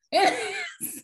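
very high-pitched laugh. Real, not acted. Teasing co-host over not liking the show, high laugh. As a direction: squeal out a quick laugh.

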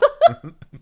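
a real high-pitched squeal of a laugh; high loud burst of laughter. Real, not acted. Laughing at her own Dipper line while recapping, high squeal.

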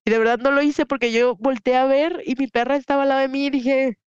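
ordinary talk

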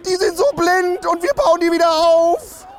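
hammed-up bellow of the coach Hansi; imitating a roar. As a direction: bellow it like a coach losing it in the cabin. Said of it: "und brüllt".

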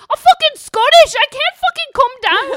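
very high, very loud Scottish yell; shouting Scottish husband. Retold or imitated. Husband told to calm down, screaming in Scottish accent. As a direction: explode in outraged broad Scottish. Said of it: "why you need to shout".

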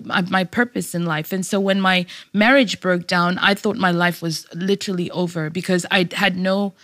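usual speaking voice

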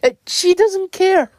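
real exasperated outburst; upset and angry. Real, not acted. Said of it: "make me feel upset and angry".

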